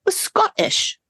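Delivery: put-on half-laughing try at a Scottish accent; bad Scottish accent that comes out British; attempted Scottish accent. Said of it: "That was more British than Scottish".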